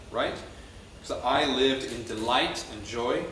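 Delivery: normal talking voice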